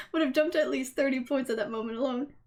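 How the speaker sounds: ordinary talk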